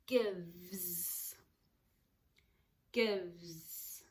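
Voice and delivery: gives sounded out slowly; sounding out words. Shown as a demonstration. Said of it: "I can hear two sounds".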